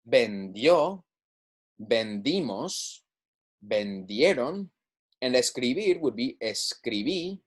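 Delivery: slow, careful verb drill; repeat-after-me drill. Shown as a demonstration. Spanish teacher leading students through conjugations, slow drill. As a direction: model each form with a pause for echoing. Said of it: "Go ahead and repeat after me".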